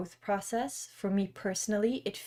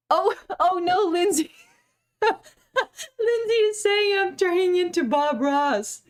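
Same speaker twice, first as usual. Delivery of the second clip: high, loud laughing exclamation; cracking up, pitched way up; 10 semitones higher, 8 dB louder, slower than usual. Real, not acted. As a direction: read it out through helpless laughter, drawn out. Laughs at a viewer's comment.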